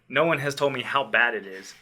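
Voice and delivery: usual speaking voice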